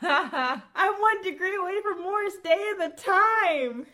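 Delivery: high, loud hammed-up boast; mock boast. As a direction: squeal it like a joke brag. Joking exaggerated boast.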